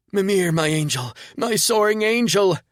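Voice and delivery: high, loud ecstatic wheeze; wheezing. Acted. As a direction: rave in a breathless high voice. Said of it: "Loopsy wheezed".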